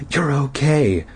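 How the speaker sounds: ordinary delivery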